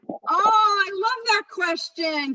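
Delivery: high, slow gushing; a wry, overdone delight at a tricky question; mock delighted. Real, not acted. Trainer greets a touchy question with mock delight.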